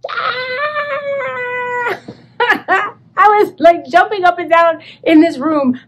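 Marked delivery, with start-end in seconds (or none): 0.1-2.9 high shriek into a laugh; excited shriek. Retold or imitated. Finding the Hermes label, a shrieking squeal. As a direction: squeal with delight.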